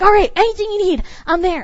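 mock eager volunteer, quick and high. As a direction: rush to offer help. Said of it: "we're like".

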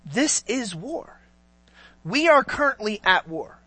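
grave sincerity, no yelling; sincere. Shown as a demonstration. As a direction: say it slowly, in earnest. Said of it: "there's a sincerity, an understanding".

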